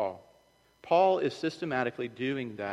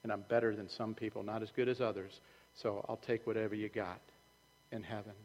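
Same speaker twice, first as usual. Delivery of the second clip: sheepish quiet; 3 semitones lower, 10 dB quieter than usual. Retold or imitated. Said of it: "You're gonna go".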